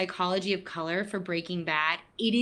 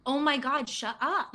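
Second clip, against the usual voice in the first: higher, fed-up groan of a reply; exasperated retort; 5 semitones higher than usual. Retold or imitated. Someone with anxiety snapping back at a friend, exasperated. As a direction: roll your eyes at useless advice. Reaction to being told chill.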